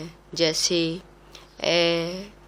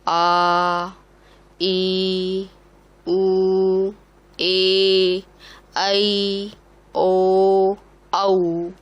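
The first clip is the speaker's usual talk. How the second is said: each vowel held for two beats, louder; long vowels; 4 dB louder, much slower than usual. Shown as a demonstration. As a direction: stretch every vowel out to a double length. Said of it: "दीर्घ, दीर्घ स्वर कहलाते हैं". (हर स्वर लंबा, दो मात्रा तक खींचकर)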